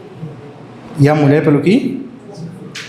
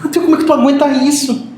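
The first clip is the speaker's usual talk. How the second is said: higher amazed question, retold; retold disbelief; 8 semitones higher than usual. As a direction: marvel at his pain, pitched up. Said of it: "Ele falou". (espanto imitado e agudo)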